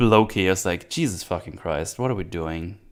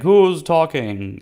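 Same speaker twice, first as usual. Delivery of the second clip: mock booming Zeus; 8 semitones higher, 6 dB louder, slower than usual. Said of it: "It's like".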